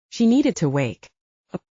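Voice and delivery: ordinary delivery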